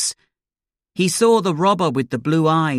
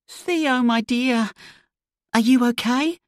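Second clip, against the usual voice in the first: worried mother; 11 semitones higher than usual. Acted. Mother hugging her frightened son, high and worried. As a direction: lift the voice up to a fretful mother.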